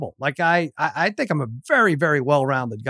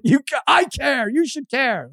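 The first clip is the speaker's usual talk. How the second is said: much higher, mock-indignant dad; exasperated protest; 9 semitones higher, slower than usual. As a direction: plead in hammed-up exasperation, pitch jumping up. Dad protests son's indifference, retold.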